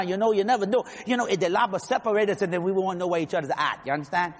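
put-on chatty, run-on Cajun accent; Cajun joke-telling. Telling a Boudreaux and Fontenot joke, Cajun accent. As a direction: tell it as two Cajun buddies.

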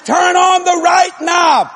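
slow, high, drawn-out holler. Retold or imitated. Husband tells wife which knob to turn, hollering. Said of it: "I'm hollering this through the floor".

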